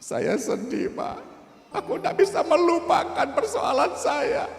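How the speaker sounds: put-on high, tearful voice of a heartbroken young man. Heartbroken young man tells the pastor he was betrayed, tearful. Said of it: "Dia nangis".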